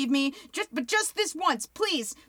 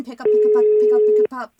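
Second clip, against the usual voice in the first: "pick up" shouted higher and louder, urgent; loud pleading; 6 semitones higher, 8 dB louder, faster than usual. Acted. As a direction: yell at the phone to answer. Begging phone to answer.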